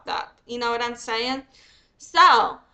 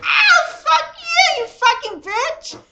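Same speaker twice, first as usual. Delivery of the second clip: high, loud screaming curse with a laugh; screamed mock insult; 8 dB louder, slower than usual. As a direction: shriek the insult, half laughing. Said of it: "That's kind of where I'm like".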